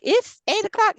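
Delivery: very high, loud panicked "It's eight o'clock"; shocked squeal. Retold or imitated. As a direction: gasp it out in alarm. Waking to daylight savings shock.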